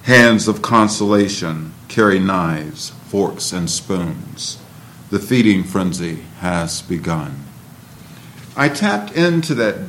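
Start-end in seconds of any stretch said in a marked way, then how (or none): 0.0-7.5 spoken word poem, somber; poem reading. Acted. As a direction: read it dark and heavy.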